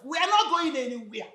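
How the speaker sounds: high-pitched, defiant assurance; stubborn but not yet shouting; firm refusal. Acted. Mother refuses to leave, first take.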